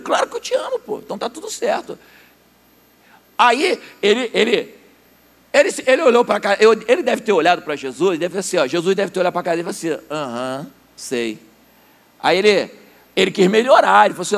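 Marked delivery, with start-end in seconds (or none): 0.0-2.0 squeaky high eager reply, voice jumping up; eager high-pitched Peter. Acted. Peter answers Jesus, squeaky and eager. 10.1-11.4 skeptical aham. Acted. Jesus doubting Peter's answer.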